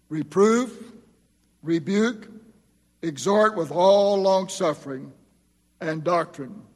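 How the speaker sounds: measured, solemn reading of the verse; scripture reading. Acted. Preacher reading scripture to the congregation, solemn. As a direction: read the charge to the church.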